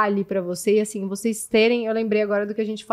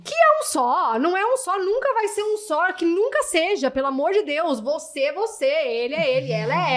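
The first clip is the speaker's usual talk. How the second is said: high-pitched protest; 7 semitones higher than usual. Acted. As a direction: voice jumps much higher, emphatic. Protesting the "one flesh" idea.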